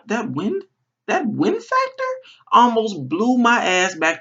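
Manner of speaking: rising outrage. Real, not acted. Building outrage retelling the storm.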